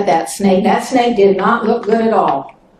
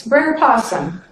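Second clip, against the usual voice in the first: ordinary delivery, nothing marked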